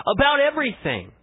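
a ringing, emphatic about everything; emphasis on everything. Shown as a demonstration. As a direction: hit about everything with full preaching force. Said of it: "I want to emphasize the word everything".

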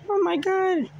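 high, loud oh my god; shocked outburst. Real, not acted. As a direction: gasp it out in alarm. Shocked at forgetting Lefty.

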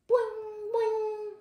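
very high, ringing boing noise; springy boing. A narrator's reading. Storyteller voicing noises that wake Tortoise, springy boing. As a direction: hold a high springy note.

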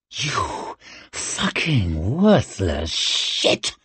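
very slow, venomous hiss. A narrator's reading. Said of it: "she hissed".

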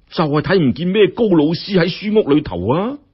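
ordinary delivery

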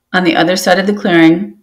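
ordinary delivery, nothing marked

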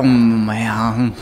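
normal talking voice

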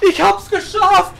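high joyful cry; excited. Real, not acted. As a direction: burst out in relief. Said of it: "repeated in rising joy".

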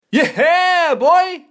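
put-on high, loud "yeah boy" cheer; hero's cocky catchphrase, yelled high; hype shout. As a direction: pump up the crowd.

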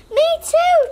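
high, bright little chirp; chiming in. Acted. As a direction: pipe up eagerly. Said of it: "chimed in the banana".

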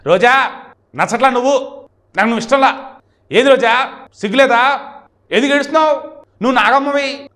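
high, loud, taunting film-style delivery; Posani Krishna Murali dialogues. Retold or imitated. Posani taunting Roja with dialogues, hammed-up and loud. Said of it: "ఓ రేంజ్లో డైలాగులు చెప్పాడు".

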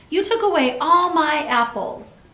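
loud, high accusation; child's shouted complaint. A narrator's reading. Andy protesting that Judy took apples, loud high shout. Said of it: "shouted Andy".

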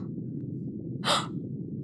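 startled unvoiced gasp; sharp gasp. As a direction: draw in breath in shock. Sees the ruined boat.

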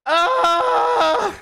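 short high cry of defeat; frustrated yell. Real, not acted. Gamer shot dead, crying out in frustration. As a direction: let out a pained howl. Dies again in game.

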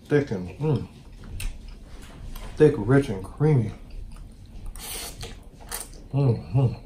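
quiet, blissful murmurs while chewing; savoring delicious food. Real, not acted. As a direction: savor each bite, hushed and dreamy. Eating, savoring the pasta.

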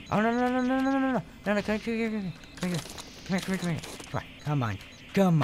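high, rising panic, begging it to come back; panicked pleading. Real, not acted. Player panics as sheep escapes.

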